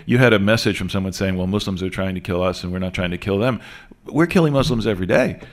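ordinary talk

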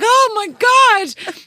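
high, slow, drawn-out relief; relieved exclaim. Retold or imitated. Mum finding a parking space, high and drawn-out with relief. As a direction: sigh it out, hugely relieved.

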